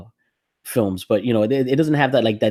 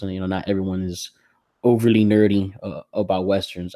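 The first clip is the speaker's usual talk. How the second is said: ordinary talk, nothing marked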